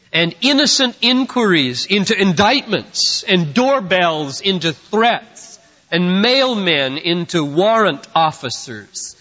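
insistent sermon cadence, piling up each item; forceful preaching. Real, not acted. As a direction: preach the list with mounting weight. Piles up examples while preaching.

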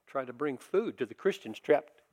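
ordinary delivery

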